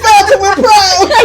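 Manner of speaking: high, loud, drawn-out announcement; laughing, over-the-top boast; mock-proud declaration. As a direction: proclaim it loudly through laughter. Acted declaration among laughing friends.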